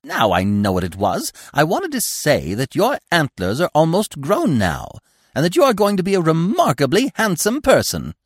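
high, effusive squirrel voice; chattering squirrel. A narrator's reading. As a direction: flatter him with emphatic, rising enthusiasm. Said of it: "he chattered on".

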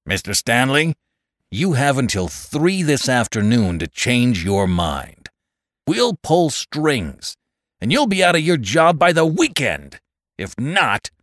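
high-pitched, heated ultimatum; raging. A narrator's reading. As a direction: rage at the boss with a threat. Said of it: "Simpson raged on".

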